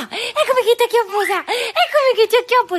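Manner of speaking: high, loud, laughing 'gonna get you'; high laughing chase call; playful chase. Real, not acted. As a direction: tease her with a laughing chase. Chasing the toddler playfully.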